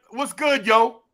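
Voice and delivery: a light put-on street greeting with yo tacked on; mock slang greeting. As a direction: toss out the yo like a parody. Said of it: "you just throw yo at the end".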